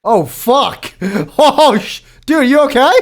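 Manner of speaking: high, loud panicked alarm. Real, not acted. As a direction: yell out in sudden panic. Friend suddenly choking.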